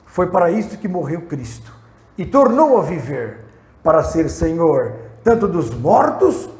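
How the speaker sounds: loud, slow solemn reading; scripture reading. Acted. Pastor reads Romans aloud to the church, loud and slow. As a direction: proclaim the verse to the church.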